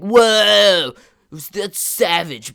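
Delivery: loud, stretched-out hype at a crazy climb; hyped-up whoa. Retold or imitated. Friend cheering him on the crane, loud excited whoa. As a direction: gush like an impressed teen bro.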